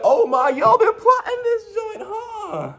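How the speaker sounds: very high, loud, drawn-out cry; mock outraged wail. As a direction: wail it out slowly in comic shock. Acts out comic outraged realisation.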